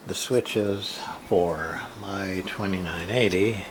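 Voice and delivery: usual speaking voice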